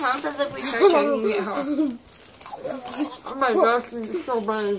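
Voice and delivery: mumbling round a mouthful; words squashed by marshmallows; cheeks stuffed full. Real, not acted.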